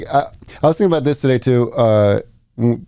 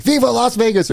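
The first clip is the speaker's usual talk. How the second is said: mock yell; 12 semitones higher than usual. Host imitates winning team yelling at celebration, mock yell. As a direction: jump way up in pitch like a celebrating champion. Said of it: "or yelling shit".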